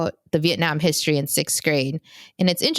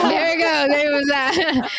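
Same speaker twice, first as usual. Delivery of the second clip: amused; 10 semitones higher, slower than usual. Real, not acted. Laughing after the tease.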